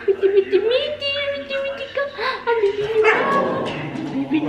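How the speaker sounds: very high, squeaky voicing of the jealous dog; high mock dog voice. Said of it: "She's barking up".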